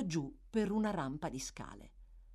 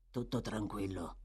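low, gruff thief's murmur; Sikes; 9 semitones lower, 6 dB quieter than usual. A narrator's reading. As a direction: lower and softer, wary villain. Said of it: "disse Sikes".

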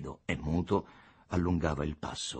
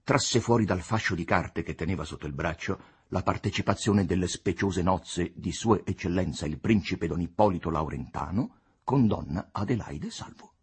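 usual speaking voice

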